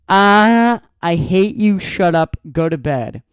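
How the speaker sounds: loud exasperated groan of a reply; fed up, telling him off; annoyed. Retold or imitated. Girl's annoyed texted reply.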